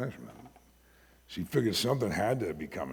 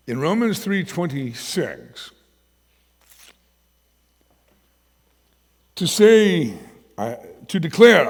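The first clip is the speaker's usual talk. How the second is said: loud, slow, high proclaiming of the verse; preaching scripture; 5 semitones higher, 12 dB louder, slower than usual. Acted. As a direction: proclaim it from the pulpit. Reads scripture with preaching force.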